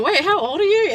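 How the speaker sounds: startled question with a laugh; mock shock. Asking her girlfriend's age, mock shocked.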